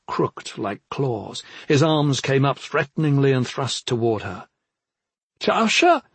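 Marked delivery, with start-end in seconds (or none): 5.4-6.0 high, urgent call; Clezia's warning call. A narrator's reading. Onlooker warning her of the threatening brother, calling out. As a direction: call out the name sharply in alarm. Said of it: "Clezia Porce called in warning".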